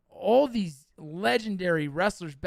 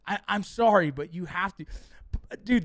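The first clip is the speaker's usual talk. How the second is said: ordinary talk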